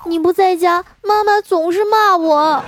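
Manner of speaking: put-on high, loud, slow little-kid whine; whiny little boy. Nephew begs his dad on the phone to come home, whining. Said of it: "他说".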